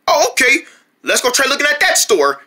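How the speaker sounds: usual speaking voice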